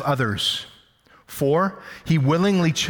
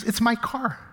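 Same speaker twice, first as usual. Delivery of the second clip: imitating wife; 8 semitones higher, 3 dB quieter than usual. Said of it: "She goes".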